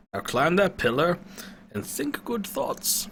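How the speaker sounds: put-on high, slow coaxing old man; old wizard urging. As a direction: send her up gently. Pentagon urging Lydia.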